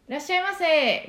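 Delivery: bright, high, projected shop welcome; firm shop greeting. Shown as a demonstration. Voice teacher demonstrating a closed voice, high shop greeting. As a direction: greet a customer with a clear ringing voice. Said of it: "こういう声なんですけど".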